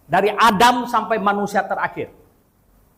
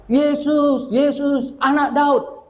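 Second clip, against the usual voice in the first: mock cry out; 3 semitones higher, slower than usual. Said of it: "dia teriak".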